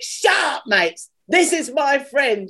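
high, emphatic retold proclamation; mock stage call-out without real volume; announcing to crowd. Retold singer hushing the crowd.

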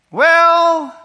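high, loud, drawn-out hedge; imitating a dad's long, loaded pause-word; reluctant critic. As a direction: stretch it out high before the criticism. Father hesitates before critiquing tape.

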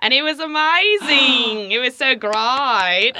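loud, high, stretched-out Australian accent. Shown as a demonstration. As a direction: gush in exaggerated Australian, drawing out the vowels. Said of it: "I guess I'll do it in Australian".

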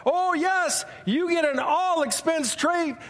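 high, sweeping prize reveal; game-show announcer. Retold or imitated. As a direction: sell the trip like a TV host. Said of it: "Jay would go on".

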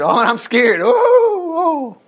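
a hammed-up frightened whimper rising high; mock a scared dying man; mocking a whimpering fearful man. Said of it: "Notice he's not saying".

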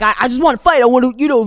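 a lightly voiced hotheaded kid; Daniel's way of talking. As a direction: play the impatient student who wants to fight. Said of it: "talk like that".

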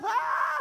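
high comic cry of terror; mock scream. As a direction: let out a high mock-terrified yell. Mock terror at brush with death.

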